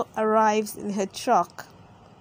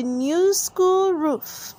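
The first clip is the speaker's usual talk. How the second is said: drawn out, higher delight; slow delighted; 7 semitones higher, much slower than usual. Acted. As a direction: stretch it out happily. Madame Gazelle delighted.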